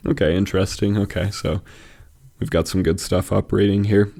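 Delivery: ordinary delivery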